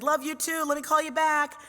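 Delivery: high, loud call back; excited phone reply. Retold or imitated. Narrator answering celebrating neighbors by phone, excited and affectionate. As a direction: holler a hurried, happy sign-off.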